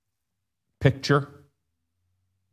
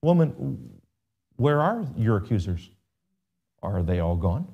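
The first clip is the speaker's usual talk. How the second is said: gentle Jesus; 3 semitones lower, 6 dB quieter than usual. Acted. Jesus speaking to the weeping woman left alone, gently. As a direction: ask softly, leaving space between phrases. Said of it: "Jesus says to her".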